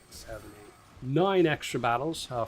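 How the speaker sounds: normal talking voice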